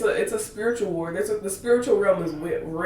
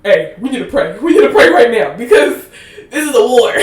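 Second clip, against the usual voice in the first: louder, urgent half-laughing call to pray; urgent wake-up call; 4 semitones higher, 13 dB louder than usual. Retold or imitated. Imagined woman waking her man at 3 a.m., urgent loud call. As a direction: rouse him, we need to pray now.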